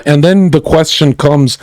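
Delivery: normal talking voice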